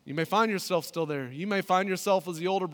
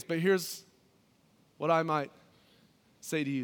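ordinary talk

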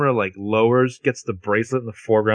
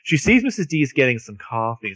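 ordinary talk

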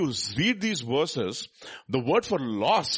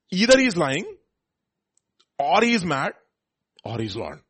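loud and high, each option hammered out; emphatic preaching; 4 semitones higher, 8 dB louder than usual. Real, not acted. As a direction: pound every option home from the pulpit. Preacher repeating the three options.

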